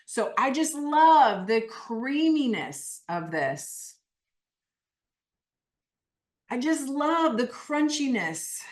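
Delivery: emphatic, drawn-out relish on "love"; animated. Shown as a demonstration. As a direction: lead them through savoring it, animated. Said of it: "why I'm saying it so animated".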